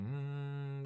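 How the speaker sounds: ordinary delivery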